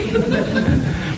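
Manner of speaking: breathless high giggle; sheepish unvoiced laugh; airy laugh. Real, not acted. Laughing at his failed prayer.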